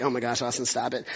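playful fed-up sigh; mock exasperation. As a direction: roll your eyes at the grammar lesson. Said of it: "and you're like".

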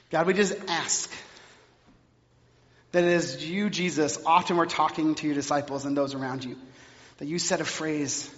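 low, hushed prayer voice. Acted. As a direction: pray it gently and humbly. Leads the congregation in prayer.

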